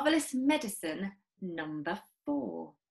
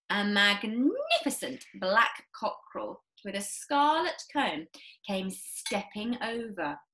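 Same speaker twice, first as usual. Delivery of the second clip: ordinary delivery, nothing marked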